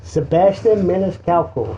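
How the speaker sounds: Sebastian Maniscalco with a slow Italian accent; a slow, rolling Italian accent. Shown as a demonstration. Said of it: "Say it a little bit more with your Sicilian accent".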